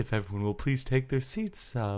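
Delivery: low, soft, hushed announcement; deadpan parody of a subdued arena welcome; mock calm announcer. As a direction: quietly ask everyone to take their seats. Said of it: "calmer, quieter start to the year".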